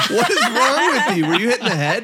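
high, incredulous laughing rant; mock outrage. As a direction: demand it in exaggerated disbelief. Mock outrage at friends' pronunciation.

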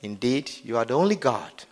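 low, soft prayer voice; leading prayer. Acted. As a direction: lead them quietly, phrase by phrase. Leads congregation in prayer.

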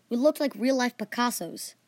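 ordinary delivery, nothing marked

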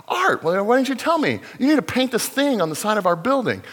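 high, excited retelling; surprised delight. Retold or imitated. Pastor recalls Hector hearing he does art, delighted high voice. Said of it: "And he goes".

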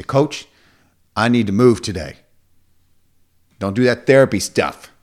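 loud, forceful imitation of a client; blunt client demand. Client telling coach to skip therapy, loud and insistent. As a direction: belt it out to shut down the talk.

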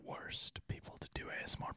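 breathy whisper, no voice; ASMR whisper. Shown as a demonstration. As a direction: whisper close to the mic. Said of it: "I may also switch in and out of both".

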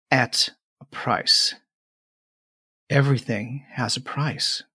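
a calm otherworldly mother speaking; voice in your head. Acted. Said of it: "you hear in your head".

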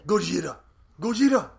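high, loud exclamation, growing bigger; mock monster-film cry. Co-host reacts to Godzilla art, mock alarmed shout. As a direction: cry out the monster's name, hammed-up.

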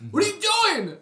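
loud high outburst; high yell. Retold or imitated. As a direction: shout it in disbelief. Repeating yell at the dog.

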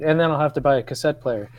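ordinary delivery

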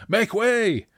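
high, loud shout to clear the path; screamed command. Acted. Rider clearing a way through the camp, screaming. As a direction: scream it out over a crowd. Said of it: "Deverk screamed".

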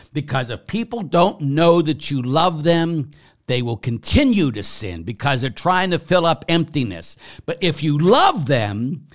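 usual speaking voice

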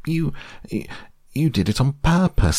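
high, spluttered accusation; halting start, then a high outburst; sputtering. A narrator's reading. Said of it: "sputtered Piglet as soon as he could speak".

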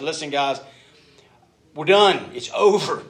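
fed-up father putting his foot down; stern dad. Retold or imitated. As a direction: lay down the law, playtime is finished. Dad scolding the three boys.

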